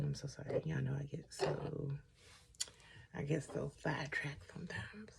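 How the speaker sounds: soft whispered sorry, a little sheepish; hushed apology. Real, not acted. Apologising to viewers for getting sidetracked, whispered. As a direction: drop to a whisper as you apologise.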